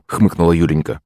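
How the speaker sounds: ordinary delivery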